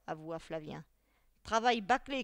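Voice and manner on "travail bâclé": loud, higher outburst of criticism; shouted. A narrator's reading. Teacher shouts at the pupil who wrote the letter. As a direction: cry out the verdict, annoyed. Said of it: "cria Xanthippe".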